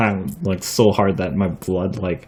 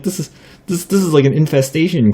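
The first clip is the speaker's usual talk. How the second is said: retold alarm; 7 semitones higher than usual. Retold protest about moths at home.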